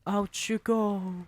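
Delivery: high, loud command; shouting. Acted. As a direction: order them out. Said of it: "she shouted".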